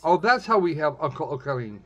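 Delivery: normal talking voice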